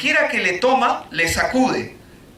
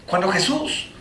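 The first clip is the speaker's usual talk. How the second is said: usual speaking voice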